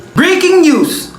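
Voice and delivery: slow, drawn-out newscaster voice; news anchor announcement. Acted. Student announcing a joke headline like a newscaster.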